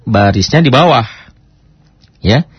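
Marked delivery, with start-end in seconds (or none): none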